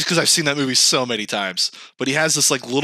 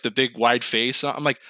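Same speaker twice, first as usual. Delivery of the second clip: ordinary delivery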